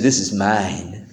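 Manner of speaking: deep, slow, soft bee threat; low, slow voice of a bully bee; low menacing bee. Retold or imitated. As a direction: growl it low like a bee guarding the soda. Bee voiced claiming the soda.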